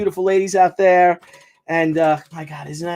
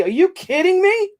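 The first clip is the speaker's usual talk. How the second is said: much higher, stunned and incredulous; shocked disbelief; 13 semitones higher than usual. Real, not acted. Reading where the flag flew, shocked and incredulous. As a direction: voice leaps up in real shock.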